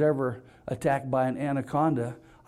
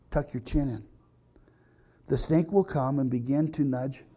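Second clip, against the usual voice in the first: mock-serious instruction reading; 3 semitones lower than usual. Reads anaconda survival instructions.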